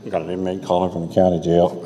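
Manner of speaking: mock robotic operator; recorded operator. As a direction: mimic the jail phone recording. Imitating jail collect-call recording.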